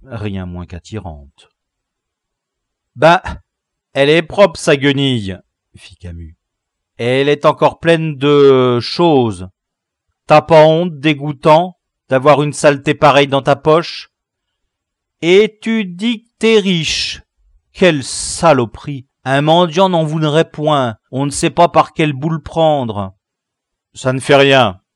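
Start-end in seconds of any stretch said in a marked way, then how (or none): 3.0-5.4 loud, mocking jeer at a rag; scornful jeer. Camus to prisoner about his rag, scornful. 7.0-23.2 put-on loud, higher, indignant boy's voice; disgusted boy, scolding. Boy shaming a prisoner over filthy pockets, loud disgust. Camus berates the prisoner in story. 23.9-24.8 put-on loud, decided boy leader; decisive. Gang leader settling the matter, loud and decisive. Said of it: "décida Lebraque".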